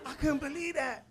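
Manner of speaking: ordinary delivery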